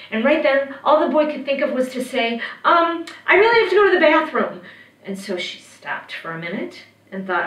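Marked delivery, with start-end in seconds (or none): 2.6-4.6 put-on high, loud boy's voice; acted flustered boy, pitched up; nervous excuse. A narrator's reading. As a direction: blurt out an urgent excuse. Boy stalls the witch.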